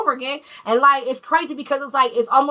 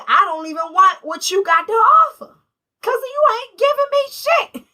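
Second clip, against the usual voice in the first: higher, louder, scornful kiss-off; fed-up outburst; 9 semitones higher, 4 dB louder than usual. Pretend. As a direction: throw it back at them, done with it. Fed-up reply to the partner.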